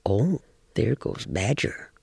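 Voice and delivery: hushed, almost whispered coyote; sly aside. Acted. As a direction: murmur it to yourself on spotting him. Coyote spies Badger passing by.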